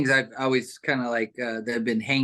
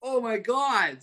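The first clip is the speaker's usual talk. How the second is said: retold awe, high and slow; slow, higher-pitched mock amazement; amazed kid; 9 semitones higher, slower than usual. Kid seeing grandma's homemade track, retold slow amazement. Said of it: "I'm like".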